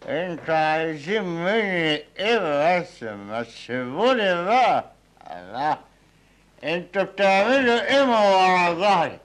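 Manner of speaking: put-on slurred drunk bravado, rising and fading. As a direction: drunk man boasting then drifting off. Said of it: "يا مسطول". (سكران بيتفاخر وصوته بيتقل)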